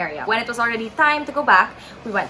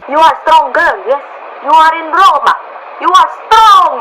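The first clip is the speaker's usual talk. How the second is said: put-on loud, high Italian-accented pep talk; rally someone in a hammy Italian accent; rousing Italian accent; 7 semitones higher, 12 dB louder than usual. Italian stranger telling crying girl she is strong, rousing Italian accent. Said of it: "My Italian accent is so bad".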